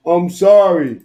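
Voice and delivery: slow, loud sorry through a laugh; drawn-out laughing sorry; laughing mock apology. Shown as a demonstration. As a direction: giggle through a fake apology. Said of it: "This is how they sound".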